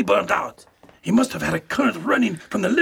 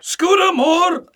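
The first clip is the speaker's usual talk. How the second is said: loud, high, drawn-out shout; alarmed long call; 7 semitones higher, 6 dB louder, slower than usual. Acted. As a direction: yell the name after someone. Calling after fleeing attacker.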